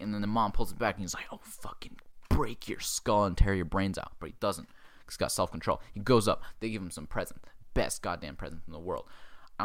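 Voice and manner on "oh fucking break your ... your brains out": slow, whispered mock menace; seething threat. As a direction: whisper it slowly like a suppressed threat. Acted seething threat held back.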